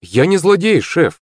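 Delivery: high-pitched, earnest protest. A narrator's reading. Акулыч denying to his boss he's a villain, protesting.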